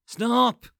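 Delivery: sudden loud, high cry of stop; a shouted final stop; desperate shout. A narrator's reading. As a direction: yell the last stop as if to end the noise. Narrator's rising plea to stop.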